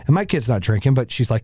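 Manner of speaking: ordinary talk, nothing marked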